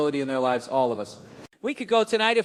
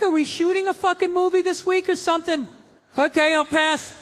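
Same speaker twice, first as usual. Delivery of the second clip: high, disbelieving squeal; incredulous; 10 semitones higher than usual. Retold or imitated. Cop reacting to Seagal trainer, high incredulous squeal.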